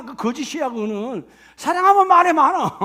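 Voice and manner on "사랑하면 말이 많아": emphatic proclamation. Acted. Emphatic sermon point.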